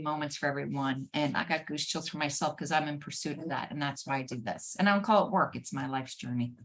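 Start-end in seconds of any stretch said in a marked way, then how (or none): none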